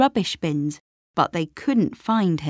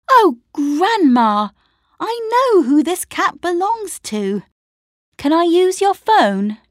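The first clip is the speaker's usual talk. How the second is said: excited realization; 10 semitones higher, 7 dB louder than usual. A narrator's reading. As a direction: burst out with sudden realization. Lucy realises whose cat.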